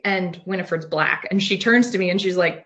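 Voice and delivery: ordinary talk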